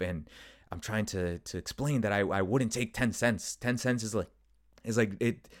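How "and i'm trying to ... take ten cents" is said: ordinary delivery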